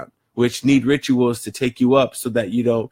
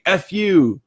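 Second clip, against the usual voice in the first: mock rude snap; 6 semitones higher than usual. Quoting a rude gesture's meaning.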